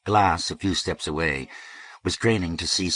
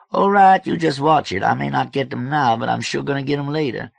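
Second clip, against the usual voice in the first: high, loud playful threat; blustering George; 9 semitones higher, 7 dB louder than usual. A narrator's reading. George warning her he'll get them later, blustering. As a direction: start with a loud high burst, keep blustering.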